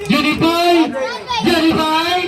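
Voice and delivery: calling out. Real, not acted. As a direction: call him over the crowd.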